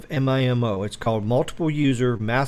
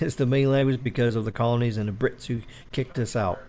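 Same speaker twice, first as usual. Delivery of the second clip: ordinary talk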